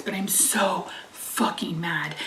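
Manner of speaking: whispered, slow, barely holding in fury; seething whisper. Real, not acted. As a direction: hiss the anger under your breath. Apologizes for cussing, seething.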